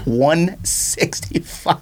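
very slow, drawn-out price reveal. Real, not acted. Host reveals his bargain to co-host, drawn-out.

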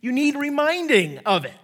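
Paul encouraging. Retold or imitated. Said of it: "He's basically saying".

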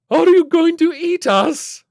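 very high, loud, slow wail; frightened cry. A narrator's reading. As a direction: cry out in alarm at a monster. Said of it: "Missus Flintlock cried".

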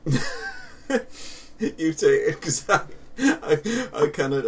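high, slow words through laughter; too giggly to get it out; laughing. Real, not acted. Can't speak for laughing.

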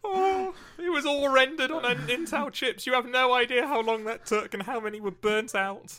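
high, loud hammed-up wailing; melodramatic, tearful mock outrage; mock-distraught employee. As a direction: lament it as if personally wounded. Acting a distraught IBM employee.

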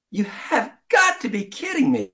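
high, disbelieving cry; can't believe his luck, cry it out; Spider-Man caught in a web, disbelieving; incredulous. Acted. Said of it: "he thought".

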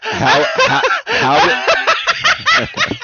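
high squeals of laughter through the words; laughing too hard to speak. Real, not acted. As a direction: try to ask while cracking up. Laughing at the photo.

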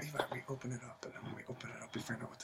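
hushed conspiratorial whisper. Acted. Delivery guy whispering to Levi about the package. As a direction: lean in and whisper the secret.

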